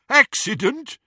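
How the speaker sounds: high, loud, rising mockery; jeering echo. A narrator's reading. Captor echoing Ron's excuse, jeering. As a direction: throw the word back as a jeer. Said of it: "There was more jeering laughter".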